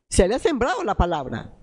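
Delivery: normal talking voice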